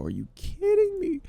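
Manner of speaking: very high, slow disbelief; incredulous squeak. Real, not acted. Gamer short on glass squeaks in disbelief. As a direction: squeak out the outrage.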